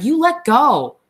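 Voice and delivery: high loud cry to let go. A narrator's reading. Curly's wife cries out at Lennie, high and loud. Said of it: "she cried".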